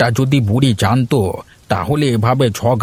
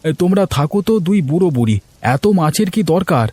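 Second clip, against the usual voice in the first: put-on much higher, worried young man; young villager calling out; 5 semitones higher than usual. Gopal calls to old Haripada in the rain, high and worried. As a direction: call out across the rain.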